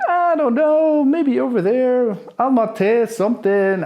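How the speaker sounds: high, loud, slow clueless tourist voice; goofy tourist. Pretend. Comic dodging the aggressive guys in a high, loud, slow tourist voice. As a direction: play dumb, cheerfully lost. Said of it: "And I'm like".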